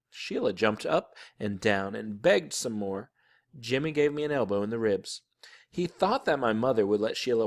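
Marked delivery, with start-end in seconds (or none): none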